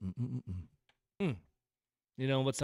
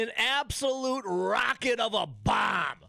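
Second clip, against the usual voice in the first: quoting the announcer; 9 semitones higher, 4 dB louder, slower than usual. Retold or imitated. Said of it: "And, and I quote".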